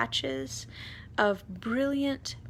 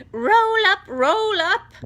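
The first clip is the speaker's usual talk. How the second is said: very loud, much higher yell; barker's yell; 12 semitones higher, 13 dB louder, slower than usual. A narrator's reading. Aunt Spiker yelling to the crowd, loud and high. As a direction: hawk it like a fairground barker. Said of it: "Aunt Spiker yelled".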